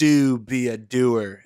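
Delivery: booming, drawn-out motivational slogan, imitated; hammed-up guru, loud and very slow; Ken Jeong. Quoting a movie guru to a friend, hammed-up loud preaching. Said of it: "Ken Jeong, and he's like".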